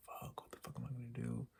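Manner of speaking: hushed, voiceless self-talk; despairing whisper. Real, not acted. Vlogger worrying over losing health insurance, whispering in despair. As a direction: whisper it to yourself, at a loss.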